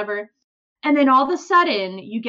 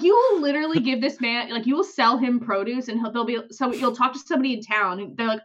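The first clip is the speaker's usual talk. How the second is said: ordinary delivery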